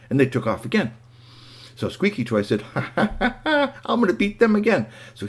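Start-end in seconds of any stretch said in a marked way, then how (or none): 2.7-4.9 high giggly boast; laugh, then crow it up high; gloating robot. Acted. Toy robot planning to win the race, gleeful.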